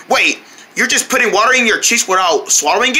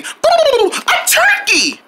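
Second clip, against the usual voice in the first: excited cry; much slower than usual. Acted. Excited turkey announcement.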